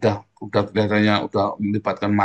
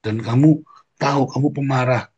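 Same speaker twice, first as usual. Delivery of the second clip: ordinary talk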